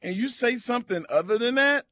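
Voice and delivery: hammed-up angry rant; mock rant. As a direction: rant at callers like a grumpy host. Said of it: "I'm mad".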